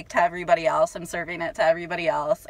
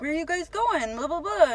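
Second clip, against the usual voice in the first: mimicking the drunk guy; 9 semitones higher than usual.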